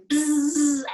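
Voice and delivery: loud, humming bee buzz; buzzing bee sound. Shown as a demonstration. Teacher shows kids bee breath, buzzing bee sound. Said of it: "make that buzzing bee sound out".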